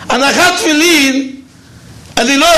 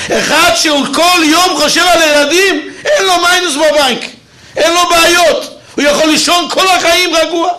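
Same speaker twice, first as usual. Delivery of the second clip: excited preaching; 6 semitones higher than usual. Real, not acted. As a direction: build the joy until it bursts out. Preacher rises to joyful climax. (הטפה נלהבת וקולנית)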